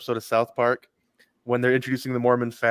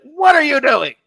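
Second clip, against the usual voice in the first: very high, loud shriek of disbelief; mock shocked wife; 13 semitones higher, 7 dB louder, slower than usual. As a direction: squeal it like a wife catching you out. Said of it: "she's like".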